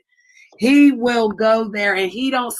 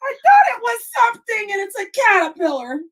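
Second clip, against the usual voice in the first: high, panicked explanation; real freak-out; 9 semitones higher than usual. Real, not acted. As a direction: squeal out what just scared you. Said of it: "I'm freaking out".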